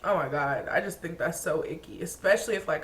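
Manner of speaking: normal talking voice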